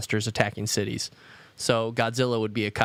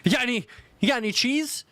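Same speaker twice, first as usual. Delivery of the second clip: goofy high voice; 11 semitones higher, faster than usual. Retold or imitated. Voicing the character asking for cheese.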